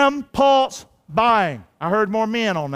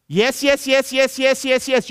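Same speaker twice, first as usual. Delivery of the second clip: mock eager yeses; 5 semitones higher, slower than usual. Mocking a habitual yes-sayer.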